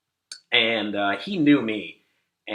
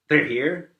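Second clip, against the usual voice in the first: "They're here?" raised higher and louder as a question; retold anxious question; 6 semitones higher, 5 dB louder than usual. Crying friend asking about the ambulance, "They're here?" as a raised question. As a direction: lift "They're here?" into a hopeful check.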